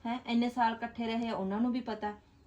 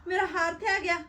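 triumphant find; 6 semitones higher, 3 dB louder than usual. Acted. Finding necklace in sister-in-law's room.

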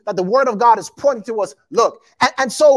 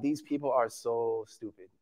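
very low, soft mock contempt; eye-rolling disdain; 13 semitones lower, 15 dB quieter than usual. Preacher voices the killer watching his victims run to him, quiet eye-rolling disdain. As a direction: mutter it like a weary, unimpressed villain. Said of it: "he rolls his eyes like".

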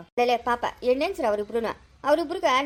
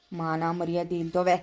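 slow, very low grumble; low, drawn-out contempt; disgusted insult; 9 semitones lower, 3 dB quieter, slower than usual. Acted. Disgusted parent muttering insult.

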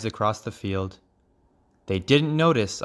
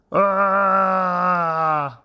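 long high scream easing downward; falling cry; 8 semitones higher, 4 dB louder than usual. A narrator's reading. Father frog as the hawk lets go, long high cry. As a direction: let out a drawn-out falling aah. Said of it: "father cried".

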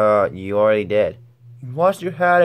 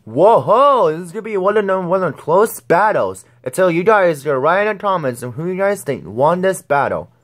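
excited host; 6 semitones higher than usual. Acted. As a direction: hype the crowd up. Host hyping the battle.

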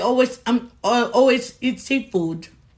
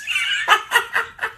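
high breathy giggle bursting out; 9 semitones higher than usual. Real, not acted. Host giggling at a viewer's comment on her live. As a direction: laugh it off lightly.

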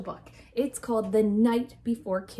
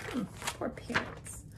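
soft sympathetic whisper, much softer; whispered aside; 10 dB quieter than usual. Real, not acted. Teacher pausing the story to pity the parents, whispering. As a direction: breathe it as a private aside.

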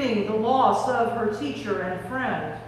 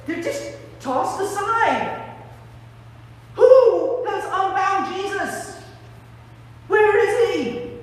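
high, agitated bewilderment; mystified; 7 semitones higher, 3 dB louder than usual. Acted. Disciples finding empty wrappings in the tomb, mystified. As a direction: stammer in loud, baffled alarm.

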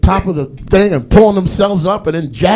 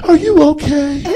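put-on clingy girlfriend; 9 semitones higher than usual. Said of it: "she'll be like".

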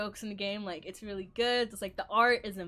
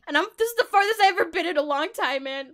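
desperate pleading; 7 semitones higher, 11 dB louder, faster than usual. Real, not acted.

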